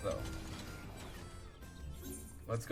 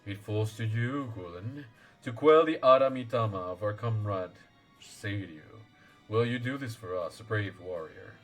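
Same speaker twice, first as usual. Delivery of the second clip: lower, solemn elder voice; wise old lord; 4 semitones lower than usual. Acted. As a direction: speak as a grave ancient guardian. Voicing Genbu lord.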